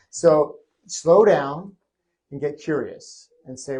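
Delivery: ordinary delivery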